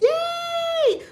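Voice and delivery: very high cheering yay; excited yay. Real, not acted. Cheering the chat's praise with a high yay.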